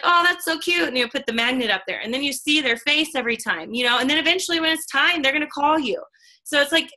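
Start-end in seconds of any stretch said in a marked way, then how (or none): 0.0-0.9 high, cooing "so cute"; delighted. Retold or imitated. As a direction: gush over a cute magnet. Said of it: "And you're like".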